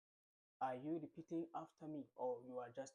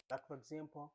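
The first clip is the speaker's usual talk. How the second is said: normal talking voice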